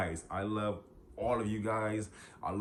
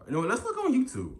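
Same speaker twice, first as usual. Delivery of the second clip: higher and louder, quick burst; sudden excited switch; 6 dB louder, faster than usual. Real, not acted. Vlogger switching to YouTube, suddenly excited. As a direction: jump in fast with a new idea.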